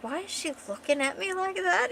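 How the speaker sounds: high, puzzled question; lightly acted bewilderment; flustered. Flustered man wonders about her.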